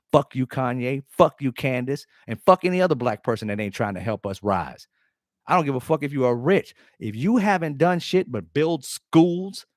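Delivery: usual speaking voice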